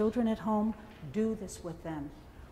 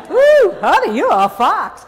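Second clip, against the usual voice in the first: much higher and louder Southern whoop; whooping Southern flirt; 9 semitones higher, 14 dB louder than usual. Retold or imitated. Woman hyping herself in the mirror, whooping loud in a Southern accent. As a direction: cheer yourself on like a Southern belle. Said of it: "sweet little Southern accent".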